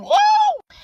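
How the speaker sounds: high delighted squeal; excited squeal. Real, not acted. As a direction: squeal with glee. Excited squeal about the man.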